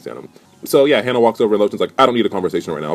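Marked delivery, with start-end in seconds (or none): none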